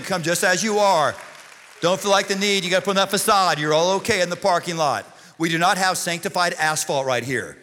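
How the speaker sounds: forceful, projected pulpit voice; full-force preaching. Real, not acted. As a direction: preach it hard to the whole room.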